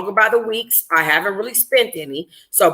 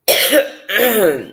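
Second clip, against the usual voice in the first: real cough breaking the reading; a dry cough. Real, not acted.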